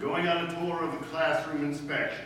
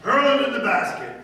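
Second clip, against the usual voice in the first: high, loud, worked-up commands; loud ranting orders; 8 semitones higher, 10 dB louder than usual. Acted. Principal rants orders at Miss Brooks, high and loud. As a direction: drive each order home, louder.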